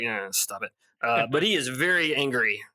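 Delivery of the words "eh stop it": low, soft, unbothered brush-off; mock-casual low aside; offhand retold dismissal. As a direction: toss it off quietly, barely bothered. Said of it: "Rand just was like".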